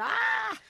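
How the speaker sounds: high, loud startled scream. Retold or imitated. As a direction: shriek at the donkeys. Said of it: "And I went".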